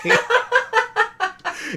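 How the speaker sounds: high-pitched burst of laughter; real giggling fit, high up; laughing. Real, not acted. Streamer amused by the knife thank-you telegram, high laughter.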